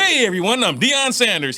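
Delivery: high, loud TV-host greeting; put-on professional voice. Coach mimicking an interviewer's intro, put-on professional voice. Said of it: "you hear the difference?".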